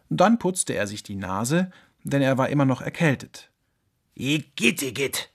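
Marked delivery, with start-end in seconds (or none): 4.2-5.3 disgusted. Acted. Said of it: "entfuhr es ihm".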